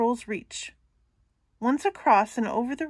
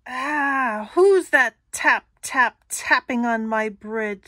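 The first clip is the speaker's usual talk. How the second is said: troll voice; 2 semitones higher, 8 dB louder, slower than usual. Acted. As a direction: boom it out from under the bridge. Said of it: "says the troll".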